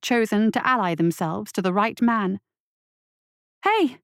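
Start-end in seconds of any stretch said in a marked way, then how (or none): 3.6-4.0 calling out. A narrator's reading. Lasky calls out to the gang.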